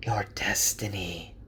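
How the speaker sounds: soft eager whisper. A narrator's reading. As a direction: whisper it again, almost eager. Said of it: "the whisper says".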